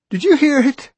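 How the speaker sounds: high and squeaking. A narrator's reading. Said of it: "high and squeaking".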